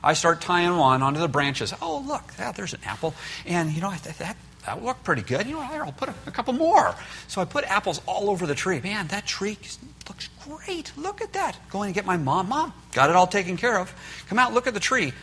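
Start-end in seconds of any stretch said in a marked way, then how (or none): 1.8-7.0 pitched way up, pleased with himself; a boy admiring his handiwork, higher; excited kid showing off a fixed tree; delighted kid voice. Retold or imitated. Boy admiring fake apples on tree. 8.8-11.5 pitched way up, pleased with himself; a boy admiring his handiwork, higher; excited kid showing off a fixed tree; delighted kid voice. Retold or imitated. 12.5-15.1 calling mom. Retold or imitated. Calling mom to see tree.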